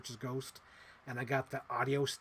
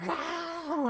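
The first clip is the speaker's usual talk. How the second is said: much higher burst of delight; excited wow. Real, not acted. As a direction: gasp it out thrilled. Excited exclamation.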